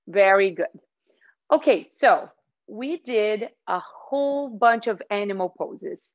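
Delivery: ordinary talk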